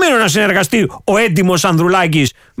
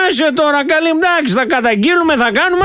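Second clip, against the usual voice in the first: high mock voice, brushing it off; mocking Nikos' inner voice; 8 semitones higher than usual. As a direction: play the sly politician voiced high. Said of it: "Σου λέει".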